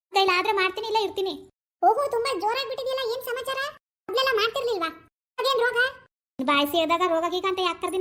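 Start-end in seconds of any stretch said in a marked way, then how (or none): 1.8-5.8 put-on a higher, nagging young wife; daughter-in-law role. Actor voices daughter-in-law scolding husband about mother. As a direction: complain sharply to husband about his mother.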